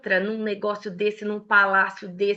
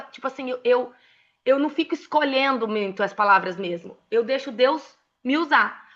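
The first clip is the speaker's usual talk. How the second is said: normal talking voice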